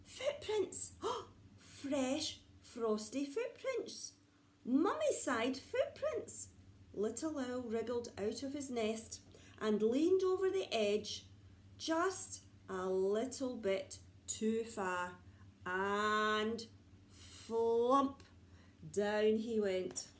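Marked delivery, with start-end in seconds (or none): 0.1-6.5 high, gasping excited exclaim; thrilled to find a clue; excited discovery. Acted. Little owl spotting footprints in the snow, excited and high. 17.5-18.2 flump. Acted. Falling sound.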